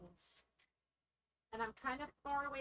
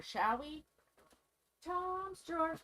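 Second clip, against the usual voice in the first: ordinary delivery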